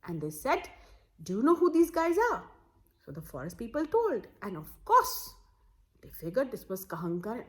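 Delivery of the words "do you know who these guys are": anxious question. Acted. Family asking forest people anxiously.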